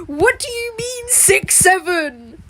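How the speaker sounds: high, slow baffled voice; hammed-up puzzled kid; mock confused kid. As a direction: play a clueless kid.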